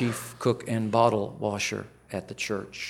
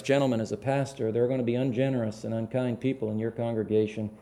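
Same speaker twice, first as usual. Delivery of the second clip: usual speaking voice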